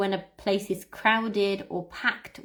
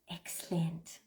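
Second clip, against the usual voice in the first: whisper; 6 semitones lower, 13 dB quieter than usual. Real, not acted. Teacher praising quietly.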